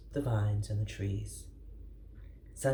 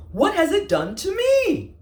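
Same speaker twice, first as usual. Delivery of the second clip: high, loud cross shout; 11 dB louder than usual. Acted. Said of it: "shouted Armadillo crossly".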